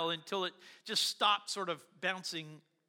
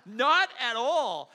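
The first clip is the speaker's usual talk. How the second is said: laughing refusal; 7 semitones higher, 11 dB louder, much slower than usual. Retold or imitated.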